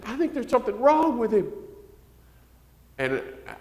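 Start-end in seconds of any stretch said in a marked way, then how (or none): 0.1-1.5 high, mimicking mother's worry; worried mother. Mother telling the pastor her son is wrong, high mimicking voice. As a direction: whine it like the fretful mom. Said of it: "she would say".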